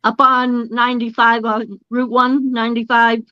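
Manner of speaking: ordinary talk, nothing marked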